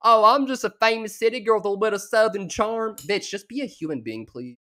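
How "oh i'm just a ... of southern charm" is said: higher, louder prissy mockery of a Southern girl; mock Southern city girl. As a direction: sneer at her as a cute Southern belle. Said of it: "add the South to everything".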